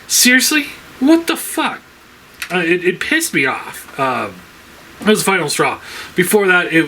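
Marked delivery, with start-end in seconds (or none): 0.1-1.8 much higher, exasperated what the fuck; exasperated outburst. Real, not acted. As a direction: throw it up in disbelief. Ranting about a bad reissue.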